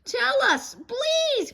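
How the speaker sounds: put-on high, loud little-girl plea; begging. Sister begging her crying mother, high pleading child voice. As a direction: beg her sweetly and urgently. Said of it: "begged Sister".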